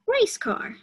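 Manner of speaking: put-on high child answering. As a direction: shout it out like an eager pupil.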